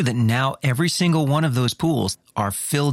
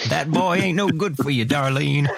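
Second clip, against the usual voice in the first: put-on old man voice; 3 semitones higher than usual.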